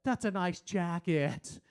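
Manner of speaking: drawn-out sarcastic compliment; slow, mock-admiring, laid on thick. Shown as a demonstration. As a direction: overdo the praise so it sounds insincere. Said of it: "It just sounds like I'm being sarcastic".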